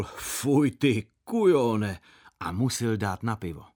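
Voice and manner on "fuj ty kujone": slow, drawn-out mock grumble, louder; a beaten wrestler grumbling as he pays up; grumbling loser. Brewer grumbling to his wrestling rival, slow and loud. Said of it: "pan Plachetka řekl".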